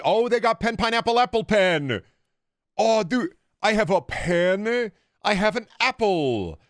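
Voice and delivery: high, gushing discovery; mock excitement. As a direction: exclaim it high, stretching the last phrases. Mock thrill at shop item.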